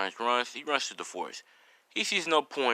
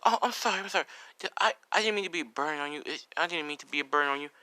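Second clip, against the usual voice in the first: put-on high, hurried apologetic boy; anxious apology; 5 semitones higher, faster than usual. Boy apologizes for being a burden, anxiously rushed. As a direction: blurt out the apology in a raised, rushed voice.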